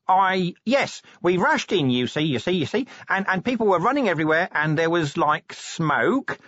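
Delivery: high, halting then rushed babble; flustered, evasive Nobby. Acted. Nobby invents an account for Vimes, flustered and high. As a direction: make it up as you go, high-voiced.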